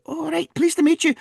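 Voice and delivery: put-on very high, tiny Scottish old lady; Missus Butler. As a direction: squeak it like a wee Missus Doubtfire. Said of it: "tiny little Missus Doubtfire, basically".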